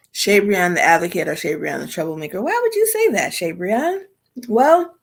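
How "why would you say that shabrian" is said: questioning viewer voice. Retold or imitated.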